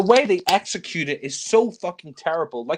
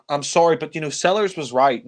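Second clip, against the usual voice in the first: normal talking voice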